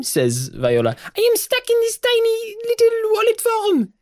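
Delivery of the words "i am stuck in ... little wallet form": very high, squeaky and fed up; a trapped little thing begging to switch; desperate tiny voice. Acted. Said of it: "desperate and angry Viola sounds".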